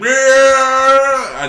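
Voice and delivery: high, loud monster roar; a sudden terrifying cry; scary shriek. Retold or imitated. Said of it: "she goes all scary".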